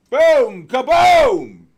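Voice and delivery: booming, high-pitched yell; exultant shout. Real, not acted. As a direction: explode on each word, holding the vowel long. Celebrating the gold move.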